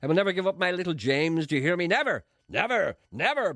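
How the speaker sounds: high, loud, building never; hammed-up Paisley-style defiant vow; defiant shout. A narrator's reading. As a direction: hammer every never like a preacher. Press baron defiant vow.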